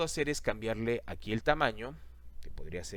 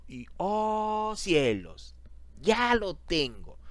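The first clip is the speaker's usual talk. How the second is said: high, loud, drawn-out fake astonishment; mock amazement; 9 semitones higher, 5 dB louder, much slower than usual. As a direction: exclaim it slowly, as if stunned by your own trick. Feigned amazement at quick result. (asombro fingido)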